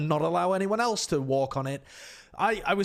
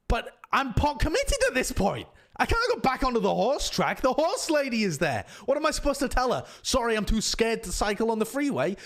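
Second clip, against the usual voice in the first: mock-panicked exasperation; 6 semitones higher than usual. Acting out panicked self-justification.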